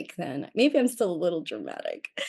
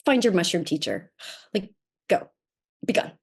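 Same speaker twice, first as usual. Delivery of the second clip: shitty voice. Retold or imitated. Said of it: "in that kind of, like, shitty voice".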